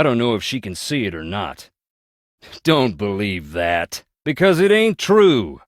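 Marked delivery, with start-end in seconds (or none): 2.6-5.6 angry denial. Acted. An old man denying the rumour to the visitor, loud angry protest. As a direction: fling the rumour back at them. Rejects rumour told about him.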